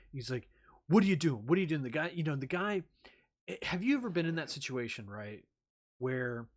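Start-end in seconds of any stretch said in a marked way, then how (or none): 0.9-1.8 much louder, exasperated demand; angry rant. Retold or imitated. Bale berating the crew member, loud angry demand. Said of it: "he talks down to the person".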